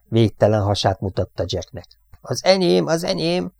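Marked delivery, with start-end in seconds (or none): 2.2-3.5 high, excited squeal of az enyém. Acted. Jack squeals at the banking fighter, high and excited. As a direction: shriek it like a hunter sure of his prey. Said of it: "visította Jack".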